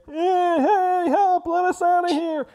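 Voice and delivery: high, far-off goblin cries, pleading; goblins crying for help. Acted. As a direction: shout up from the pit like desperate little goblins. Trapped goblins calling from pit.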